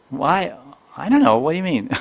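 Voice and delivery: put-on high, loud kid baffled by the question; puzzled child imitation. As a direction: play a little kid who finds this baffling. Voicing puzzled child replying.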